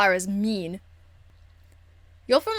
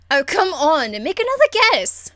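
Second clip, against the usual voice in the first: eager plea; 7 semitones higher, 5 dB louder than usual. Real, not acted. Teasing companion, pleading for a guess.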